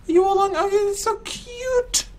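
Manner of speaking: very high, drawn-out gushing coo; cute cooing. Real, not acted. Streamer gushing over a cute baby animal, high drawn-out coo. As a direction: squeal with delight, stretching the last word.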